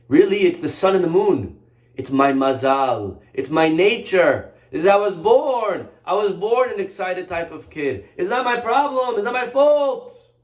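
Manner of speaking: hammed-up indignant blame-shifting; acted protesting. Acted excuse, blaming everything.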